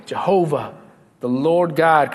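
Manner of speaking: ordinary talk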